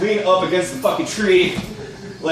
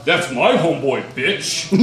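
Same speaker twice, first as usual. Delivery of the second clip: a touch lower, mocking copy of the comeback; mocking the retort; 3 semitones lower than usual. Brawler pinning the narrator, a lightly voiced smug echo. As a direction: throw his own line back at him, smug. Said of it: "and he's like".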